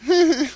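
high little-man titter; short, high embarrassed laugh; nervous chuckle. A narrator's reading. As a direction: giggle sheepishly.

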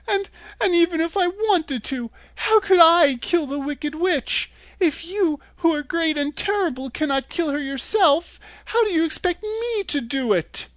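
put-on high, crying little girl's plea; sobbing girl. A narrator's reading. Dorothy sobbing before the great head, high and crying. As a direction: let the voice cry through the words. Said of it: "she sobbed".

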